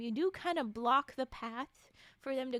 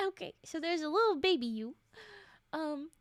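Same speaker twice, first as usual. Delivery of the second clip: ordinary talk, nothing marked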